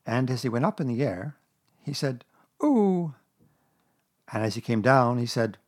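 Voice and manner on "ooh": high "ooh" of a jolted passenger. A narrator's reading. Piglet tossed up in the air, jolted ooh. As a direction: let out a raised, startled ooh.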